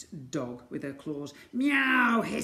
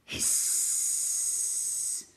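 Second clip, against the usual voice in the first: long unvoiced hiss; cat hiss. A narrator's reading. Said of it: "That was the cat".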